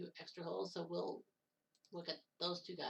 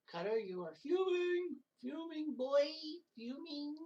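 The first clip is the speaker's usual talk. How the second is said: high put-on teasing voice, ribbing himself; squeaky mock taunt at his own temper; 9 semitones higher, 4 dB louder, slower than usual. Commentator teasing himself for fuming in a high mock voice.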